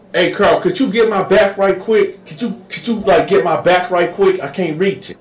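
high coaxing voice; high wheedling request; Sweet Lo asking. Retold or imitated. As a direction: sweet-talk him. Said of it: "He like".

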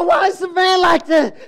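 high, loud shout about the mess; mock outraged dad yelling; exasperated shout. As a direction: yell at the messy van. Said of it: "I would go".

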